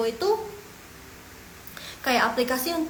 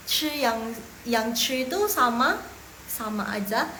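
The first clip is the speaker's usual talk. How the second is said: ordinary delivery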